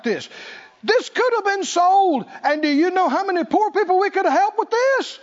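high, indignant complaint about waste; hammed-up indignant grumbling; mock indignation. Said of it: "said it with indignation".

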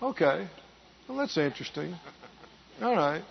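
soft, low, lukewarm murmur; mock a half-interested churchgoer, quietly; unimpressed listener. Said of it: "If you just sit in here and go".

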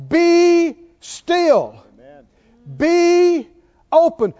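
loud, high, long-held BE; booming drawn-out decrees; commanding. Retold or imitated. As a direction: proclaim each command slowly and forcefully. Repeating Jesus' commands in proclamation.

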